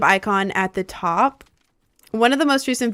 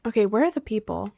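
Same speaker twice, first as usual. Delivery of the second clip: usual speaking voice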